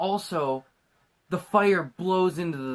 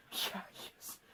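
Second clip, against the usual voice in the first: hushed, voiceless aside; conspiratorial mock whisper; whispered agreement; 17 dB quieter than usual. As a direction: whisper it like a secret confidence. Acting confiding agreement.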